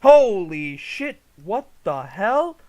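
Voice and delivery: loud, drawn-out shock; real startled swearing; startled outburst. Real, not acted. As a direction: blurt it out loud and slow, in shock. Startled by the trailer's explosion.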